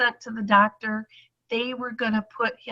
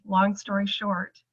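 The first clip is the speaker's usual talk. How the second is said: ordinary delivery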